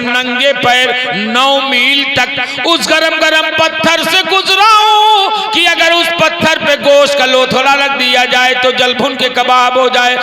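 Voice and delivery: high, loud, half-chanted declamation; full-force preaching. Acted. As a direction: recite the story fervently, drawing out the cries. (बुलंद, लंबी खिंची जोशीली तकरीर)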